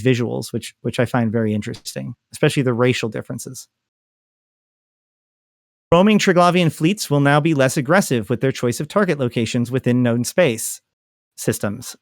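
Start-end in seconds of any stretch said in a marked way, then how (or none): none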